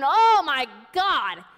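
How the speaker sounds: loud, drawn-out cry of alarm; retold panic. Nurse running as the hospital erupts, retold panicked cry. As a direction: imitate a panicked nurse crying out. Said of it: "but they panic".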